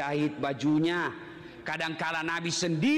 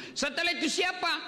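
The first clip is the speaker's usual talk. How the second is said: the questioner; 10 semitones higher than usual. Acted. Questioner asks again.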